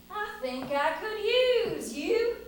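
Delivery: high, slow, drawn-out realisation; dawning idea. Acted. Cowboy sees a use for the snake, dawning delight. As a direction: stretch it out as the idea lands.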